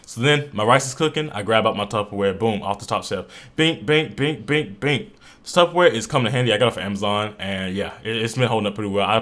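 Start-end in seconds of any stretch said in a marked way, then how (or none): 3.5-5.1 higher-pitched little binks, each falling; bouncy "bink" sound effects. Shown as a demonstration. Cook grabbing Tupperware off the shelf, playful bink noises. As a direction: mimic items popping down one by one.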